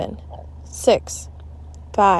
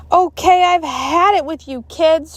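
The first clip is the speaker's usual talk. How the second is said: angry shout; 9 semitones higher, 5 dB louder than usual. A narrator's reading. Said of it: "she shouted".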